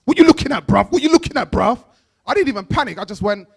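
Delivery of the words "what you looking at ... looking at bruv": put-on louder, challenging London street accent; London youth accent. Youth on the bus confronting him, London roadman accent. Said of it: "one of them was like".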